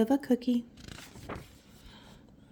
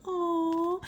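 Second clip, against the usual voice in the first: tender; 7 semitones higher, 5 dB quieter than usual. Real, not acted. Touched reaction.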